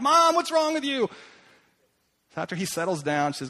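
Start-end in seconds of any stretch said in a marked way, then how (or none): 0.0-1.1 put-on high, loud kid's outburst; flipping out. Boy flipping out at his mom, high and loud. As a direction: shriek it like a shocked boy. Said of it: "flipping out".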